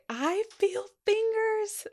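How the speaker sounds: high, slow thrilled announcement; excited. Retold or imitated. Midwife thrilled to feel the baby, high and slow. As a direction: imitate the midwife's delight, stretched out. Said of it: "she was so excited".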